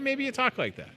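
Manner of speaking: ordinary talk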